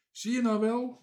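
high-pitched, vindicated call; indignant cry. A narrator's reading. Bange Toontje to the doubters, crying out indignantly. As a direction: cry it out high, proven right. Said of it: "riep Bange Toontje".

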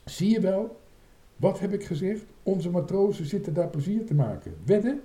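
high, eager I-told-you-so voice; excited high-voiced challenge; triumphant calling. A narrator's reading. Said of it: "riep ze".

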